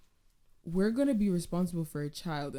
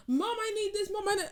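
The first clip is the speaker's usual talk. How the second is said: put-on much higher pleading child voice; whiny kid; 12 semitones higher than usual. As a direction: nag mom like a needy little kid. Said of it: "they come in and they're like".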